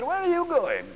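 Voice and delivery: high, odd little creature voice; sponge voice. Acted. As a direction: pipe up like a curious sponge.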